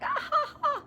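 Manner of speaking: high, squeaky imitated chuckle; mocking laugh. Dean laughing off a student's complaint, high mock chuckle. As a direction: mimic the dean laughing you off.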